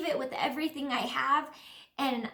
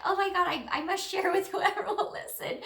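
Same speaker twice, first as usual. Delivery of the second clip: laughing excitement; 6 semitones higher than usual. Retold or imitated. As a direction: bubble over with the urge to share. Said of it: "it's like".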